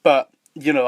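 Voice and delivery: normal talking voice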